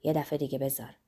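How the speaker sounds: low, hushed request after a deep breath; quiet, subdued asking; soft request. A narrator's reading. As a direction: say it softly and low, letting it fall. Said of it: "نفس بلندی کشید و آهسته گفت".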